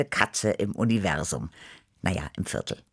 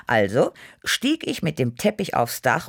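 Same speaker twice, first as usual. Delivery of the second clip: ordinary delivery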